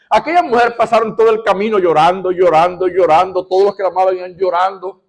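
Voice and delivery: loud, high, driving preacher voice; fervent preaching. Acted. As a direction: preach it with rising fervour. Passionate preaching on weeping.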